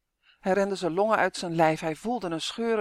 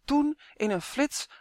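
usual speaking voice

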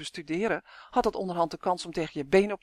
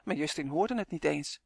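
plain reading voice

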